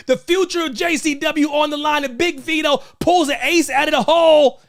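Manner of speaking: rising wrestling hype, from calm to high; building excitement. Real, not acted. Host recapping the big match to viewers, rising hype.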